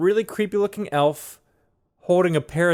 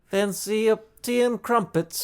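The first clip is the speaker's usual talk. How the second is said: a higher, slow hammed-up English accent; mock English accent; 7 semitones higher, slower than usual. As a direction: play the fake Englishman offering tea. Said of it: "All of my English accents".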